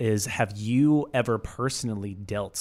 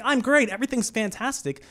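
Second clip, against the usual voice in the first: fake cheerful; 11 semitones higher than usual. Mock fake cheerful answer.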